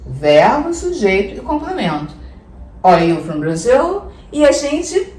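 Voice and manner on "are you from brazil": "Brazil" lifted at the end as a question; rising question intonation. Shown as a demonstration. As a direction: ask it, letting "Brazil" climb upward. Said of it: "dá uma entonação um pouco pra cima".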